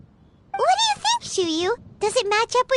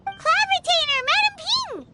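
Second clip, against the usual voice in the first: higher, sudden call of a name; surprised call; 9 semitones higher than usual. Acted. Girl walking up, catching the two talking, calls out in surprise. Calling out, interrupting the pair.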